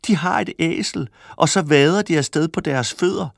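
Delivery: calling out. A narrator's reading. Market crowd mocks walkers who own a donkey, calling out. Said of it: "De råbte også op".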